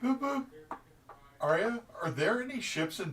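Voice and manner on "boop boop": high beeping; comm beep noise. Acted. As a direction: chirp it like a comm badge. Comm badge tap beep.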